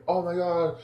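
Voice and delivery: high-pitched acted astonishment; mock amazement. Viewer retelling the dragon reveal, acted astonished. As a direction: retell a wide-eyed reveal.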